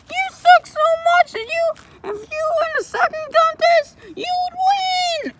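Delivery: very high squeaky taunting voice. Acted. Creature taunting the visitor in a squeaky high voice. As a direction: squeak out the comeback.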